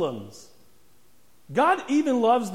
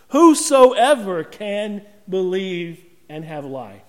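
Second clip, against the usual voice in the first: louder and slow, every word weighted; slow emphatic declaration; 5 dB louder than usual. Real, not acted. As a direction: hammer the promise home. Preacher drives home the point.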